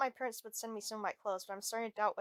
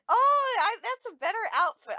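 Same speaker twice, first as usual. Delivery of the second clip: excited; 11 semitones higher, 12 dB louder than usual. Acted. Character reacts to outfit.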